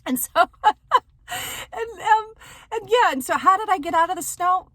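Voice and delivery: struggling to talk through the giggles; laughing. Real, not acted. Laughing at her own story.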